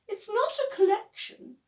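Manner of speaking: high, put-on posh voice; plummy mock-posh announcement. A narrator's reading. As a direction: play the grand lady. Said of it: "says Beaky in a posh voice".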